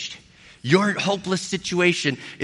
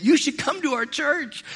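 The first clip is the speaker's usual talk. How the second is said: higher, bright-eyed mock voice of a flight attendant; excited flight attendant; 9 semitones higher than usual. Flight attendant tells a coworker her pastor is aboard, in a high mock voice. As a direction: play the thrilled attendant pointing out her pastor, pitched up. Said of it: "she said to the other flight attendant".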